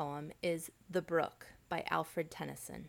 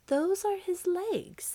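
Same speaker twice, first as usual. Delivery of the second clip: high, slow voice for the giant's wives; 13 semitones higher, 7 dB louder, slower than usual. Acted. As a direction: lift the voice high and slow for the women. Giant's wives point out legs.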